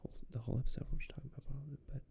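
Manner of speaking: fast conspiratorial whisper; scheming whisper. Retold or imitated. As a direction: rush it out under your breath.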